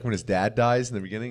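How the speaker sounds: ordinary delivery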